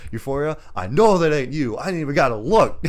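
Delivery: high, loud retold rebuke; mock telling-off. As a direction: imitate a film hero snapping at an interrupter. Acts hero telling off Euphoria.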